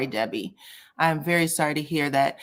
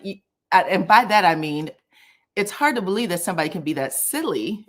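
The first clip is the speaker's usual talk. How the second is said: ordinary talk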